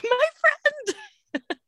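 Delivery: high, loud mock scream of delight; retold scream. Co-host retells seeing a friend's face pop up, mock screaming. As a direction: squeal it loudly, imitating your own delighted scream. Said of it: "I, like, screamed".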